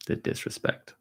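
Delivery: under the breath, voice dropping to almost nothing; a quiet, breathy, mock-wounded aside; muttered aside. Real, not acted. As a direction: mutter it to yourself, half-joking offense. Mock-offended aside about daughter.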